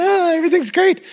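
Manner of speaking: high-pitched, gushing ad parody; mock cheery ad. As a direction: imitate a blissfully happy campaign commercial. Mimicking a cheery campaign ad.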